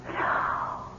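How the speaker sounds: a breathless whispered no; stunned whisper. Acted. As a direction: barely breathe the word out in disbelief. Sees dead woman alive.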